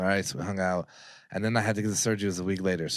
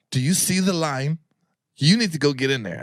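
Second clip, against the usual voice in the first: stern receptionist; 5 semitones higher, 5 dB louder than usual. Retold or imitated. Receptionist scolding him at window.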